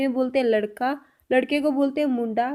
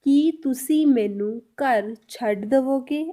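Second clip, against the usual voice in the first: slow it right down so each word is clear; धीरे-धीरे, हर शब्द अलग; much slower than usual. Shown as a demonstration. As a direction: spell out every word of the Punjabi slowly. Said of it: "तो इसको हम पंजाबी में कैसे बोलेंगे?".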